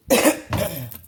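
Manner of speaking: harsh hacking cough; smoker's cough. Real, not acted. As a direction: cough hard and rough. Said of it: "That smoker's cough".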